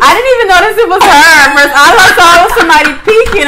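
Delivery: high, loud through laughter; laughing too hard to stay steady. Real, not acted. Both laughing at package.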